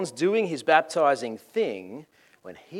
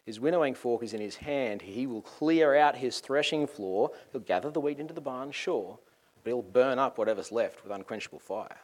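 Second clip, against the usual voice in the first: ordinary delivery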